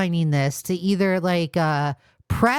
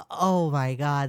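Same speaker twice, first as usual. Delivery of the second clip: ordinary talk, nothing marked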